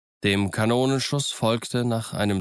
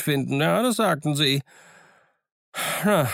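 higher, lively voice of a half-wild marooned sailor; higher-pitched, eager old castaway; eager castaway Ben Gunn; 9 semitones higher than usual. Acted. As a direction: play the odd, chattering island hermit, pleased with himself.

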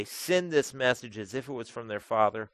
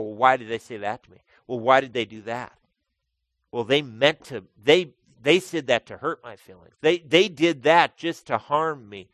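imitating a hurt complainer; 9 dB louder than usual. Mimics hurt person's complaints.

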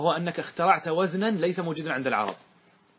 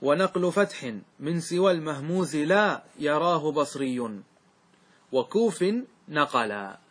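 verse recited; slower than usual. Shown as a demonstration. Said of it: "ثم قال".

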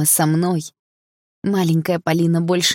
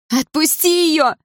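high, drawn-out desperate shout; screaming; 12 semitones higher, 4 dB louder, slower than usual. A narrator's reading. Daughter screams at stepfather to release mother, screaming. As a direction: yell it high and long. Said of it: "закричала я".

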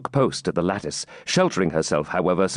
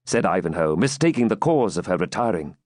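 ordinary delivery

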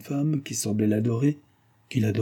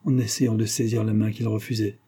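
ordinary delivery

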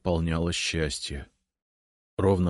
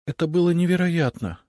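trembling voice; 11 semitones higher, 4 dB louder than usual. A narrator's reading. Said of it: "дрогнувшим голосом сказала Лиза".

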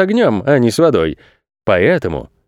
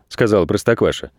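ordinary delivery

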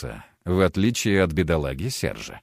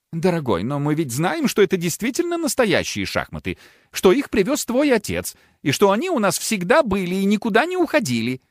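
higher voiced pleading wife; a pleading wife, pitched up; 9 semitones higher than usual. A narrator's reading.

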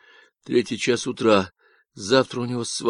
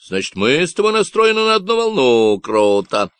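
loud, nasal Texan ranting about ecologists; a cocky stranger sounding off; Davy's Texan voice; 6 semitones higher, 6 dB louder than usual. Acted. Stranger on the radio ranting about ecologists, nasal Texan.

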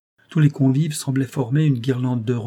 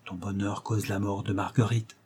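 murmure à l'oreille; 6 dB quieter than usual. A narrator's reading. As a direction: murmur it discreetly into a friend's ear. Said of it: "murmura Dagobert à l'oreille du major". (murmuré doucement à l'oreille)

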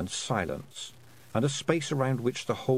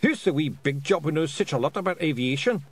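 high Scottish pictsie voice; pictsie pilot; 6 semitones higher than usual. A narrator's reading. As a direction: take a dig in a raised Scots voice. Pilot speaks in character voice.

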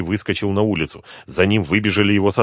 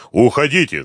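raised, urgent yell; loud order, pitched up; 5 semitones higher, 5 dB louder than usual. A narrator's reading. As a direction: shout them out of danger. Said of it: "заорал он".

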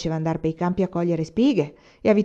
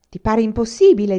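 loud, offended retort; stung that you'd doubt him; resentful; 4 semitones higher, 8 dB louder than usual. A narrator's reading. Old carpenter offended by the student's smile, resentful.

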